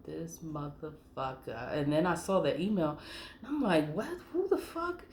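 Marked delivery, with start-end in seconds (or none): 0.0-1.7 quietly seething at him; muttered curse. Retold or imitated. Reacting to the liar. 3.9-5.0 much higher, stunned disbelief; shocked outrage. Retold or imitated. Opening the email from the liar, a high shocked outburst.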